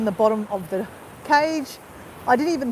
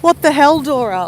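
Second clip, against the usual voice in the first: loud mocking what the hell; mock viewer exclaiming; 4 semitones higher, 8 dB louder than usual. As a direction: call out like a viewer laughing at her.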